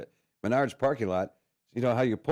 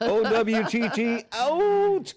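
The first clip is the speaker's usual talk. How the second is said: drawn-out high, loud call; mock announcer shout; 12 semitones higher, 5 dB louder, much slower than usual. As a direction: belt it out slowly, letter by letter. Spelled-out shout, drawn long at show opening.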